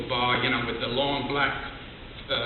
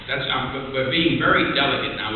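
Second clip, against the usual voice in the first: normal talking voice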